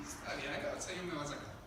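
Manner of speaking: low, soft, quick aside; confiado, en voz baja. Acted. Pablo preso, confiado en voz baja. (en voz baja y confiada)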